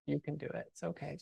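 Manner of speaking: very soft mock pep talk to tired equipment; soft coaxing. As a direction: coax it along quietly, like reassuring someone weary.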